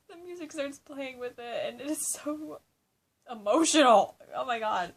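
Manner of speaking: carried away by the sad scene, almost yelling; overwhelmed. Real, not acted. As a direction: voice rising high and bursting loud on emotional. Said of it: "I'm crying".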